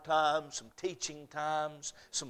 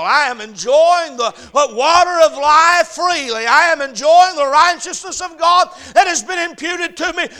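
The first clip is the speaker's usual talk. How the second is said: loud, high testimony rising to a shout; building to full-force preaching; 11 semitones higher, 19 dB louder than usual. Real, not acted. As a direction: proclaim it ever louder to the whole church.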